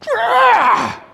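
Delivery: sharp high yell of outrage; outraged shout. Retold or imitated. Shopper exploding at the price of peas, high outraged yell. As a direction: let out one loud high cry of indignation. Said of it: "I got very upset".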